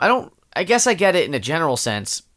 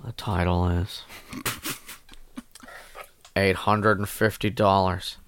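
deadpan boring read; 8 semitones lower, 7 dB quieter, slower than usual. Acted. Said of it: "what's with the tone".

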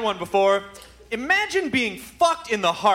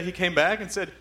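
usual speaking voice

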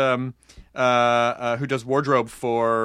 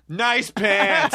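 higher, drawn-out sarcastic compliment; 7 semitones higher than usual. Shown as a demonstration. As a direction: exaggerate the praise so it sounds fake. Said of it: "sarcastically to the people".

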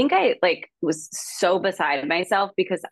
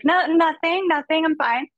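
forced denial; 11 semitones higher than usual. Retold or imitated. Denying she's upset, then cries.

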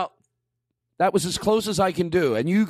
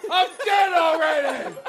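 energetic dying guy; 9 semitones higher, slower than usual. Acted. Shot man hamming up his own death. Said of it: "dying with a lot of energy".

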